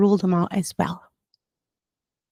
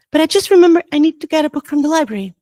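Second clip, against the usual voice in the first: fast, anxious excuse; nervous rush; 6 semitones higher, 5 dB louder, much faster than usual. A narrator's reading. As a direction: blurt the excuse quickly. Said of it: "getting more and more nervous".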